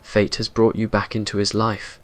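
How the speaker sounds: ordinary delivery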